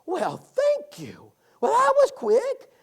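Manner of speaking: high, drawn-out delighted reply; put-on high woman's voice, slow; woman at the door. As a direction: stretch it out high like a surprised lady of the house. Retold woman's reply at her door.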